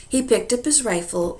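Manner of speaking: ordinary delivery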